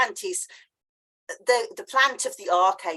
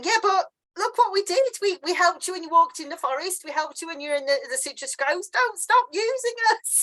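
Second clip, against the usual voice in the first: high, pleading plant voice; voicing the plants; 9 semitones higher than usual. Plants pleading with the friend not to be abandoned, high and squeaky. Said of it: "the plants are going".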